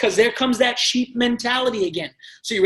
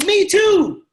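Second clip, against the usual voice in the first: high and loud, all in; the excited answer to any reply; enthusiastic agreement; 9 semitones higher, 5 dB louder than usual. Acted. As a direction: beam it back at them. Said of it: "Here's my answer".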